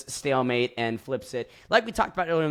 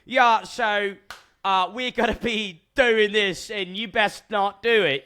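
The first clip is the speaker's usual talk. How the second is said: smug mock-up of Theo standing up, pitched up high; a cocky housemate declaring the plan, pitched up high; 8 semitones higher, slower than usual. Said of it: "and going".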